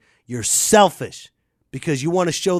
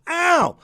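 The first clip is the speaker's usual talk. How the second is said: mock yelp; 13 semitones higher, 4 dB louder than usual. Acted kid being hit.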